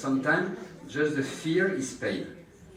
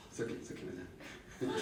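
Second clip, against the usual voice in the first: 1 soft reassurance; 7 semitones lower, 15 dB quieter, much faster than usual. Real, not acted. Calming the patient during injection.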